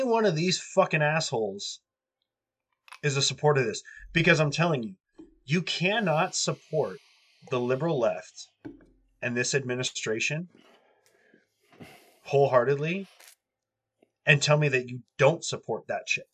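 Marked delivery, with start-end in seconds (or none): none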